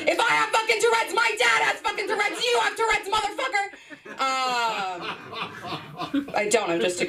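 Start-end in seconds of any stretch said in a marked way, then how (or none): 0.1-3.7 high, fast yelling rant; mock outraged outburst. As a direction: explode at the psychiatrist in one rush. Acted outburst at psychiatrist.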